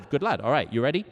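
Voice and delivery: usual speaking voice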